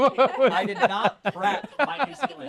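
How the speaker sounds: high, laughing through the words. Real, not acted. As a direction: giggle it out, embarrassed. Laughing at his failed accent.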